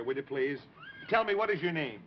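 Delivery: usual speaking voice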